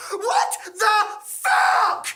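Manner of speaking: high, loud, drawn-out scream. Acted. Sarah seeing Thomas gored, slow shocked scream. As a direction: stretch it into a shocked shriek. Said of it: "Sarah screamed".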